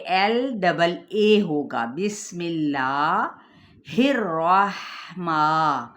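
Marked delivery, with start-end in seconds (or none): 1.8-5.8 very slow, with a thin lam and a doubled ra; slow tajweed recitation. Shown as a demonstration. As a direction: recite بسم اللہ الرحمن slowly for students. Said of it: "اب یہ دیکھیں".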